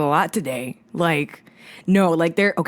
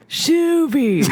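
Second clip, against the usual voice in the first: California surfer voice; 8 semitones higher than usual. Pretend. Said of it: "you got California surfer voice".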